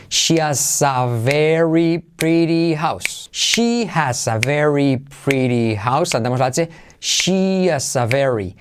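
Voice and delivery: slow drilled model sentence; repeat after me. Shown as a demonstration. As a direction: say it slowly for the class to echo. (repetición lenta conmigo)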